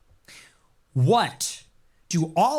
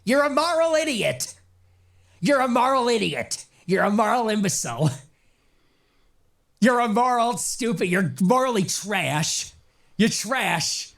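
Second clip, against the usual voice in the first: mock Ben Shapiro rant; 5 semitones higher than usual. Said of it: "for engaging in what he said was an act of moral imbecility".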